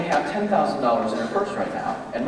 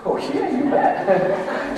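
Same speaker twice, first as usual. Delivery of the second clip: eager yes; 9 semitones higher, much slower than usual. Pretend. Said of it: "Kelly, I'm gonna give you two thousand dollars".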